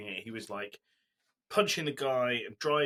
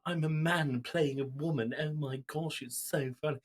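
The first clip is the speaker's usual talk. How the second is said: higher, lightly hammed-up comic mother; mock Vine woman; 4 semitones higher than usual. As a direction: send up a man playing a woman. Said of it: "you know Vine humor where someone puts a tea towel".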